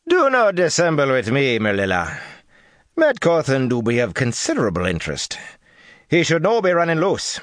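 put-on high, stern, clipped foreign accent; stern foreign woman. A narrator's reading. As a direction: scold her with cold authority. Teslin rebukes Merlilla, accented speech.

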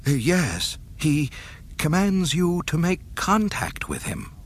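anxious subordinate delivering bad news; frightened officer. Admiral keeps trembling.

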